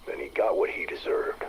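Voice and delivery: ordinary talk, nothing marked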